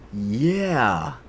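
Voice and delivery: a drawled American "Yeah"; imitating the voice actor's American "Yeah"; American accent. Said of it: "with the most American accent ever".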